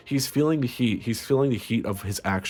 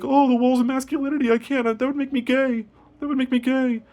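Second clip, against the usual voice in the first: much higher, squeaky mock panic; mocking the straight ex; 3 dB louder than usual. Ex panicking about masculinity, high mock whine. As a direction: whine like a scared straight guy. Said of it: "he's like".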